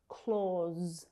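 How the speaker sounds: "Clause" drawn out as "clawz" with buzzing end; voiced Z ending. Shown as a demonstration. Teacher putting the sounds together for learners, "Clause" ending in buzzed Z. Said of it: "Voiced Z sound".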